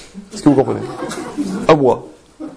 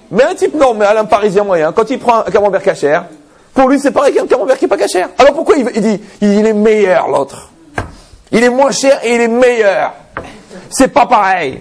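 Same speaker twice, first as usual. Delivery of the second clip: much higher and louder, building excitement; animated punchline; 7 semitones higher, 3 dB louder than usual. Real, not acted. Preacher mocking the Parisian who swears the forbidden cheese tastes better. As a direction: push the punchline with rising zeal.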